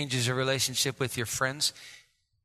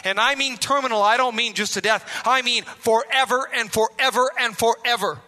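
ordinary talk, nothing marked

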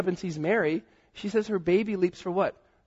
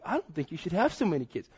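ordinary delivery

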